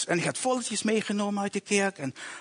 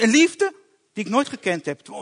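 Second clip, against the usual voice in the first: normal talking voice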